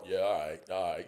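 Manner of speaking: low, soft grudging acknowledgement; restrained tolerance. Retold or imitated. Grown man putting up with a barking kid, low and restrained. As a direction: keep it down, just putting up with it. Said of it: "Bing's just kinda going".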